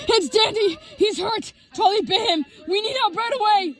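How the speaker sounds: high, urgent cries; panicked yell. A narrator's reading. Gray reports the bite to TV Man, yelling in panic. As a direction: blurt each phrase high in acted panic. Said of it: "I yelled in a panic".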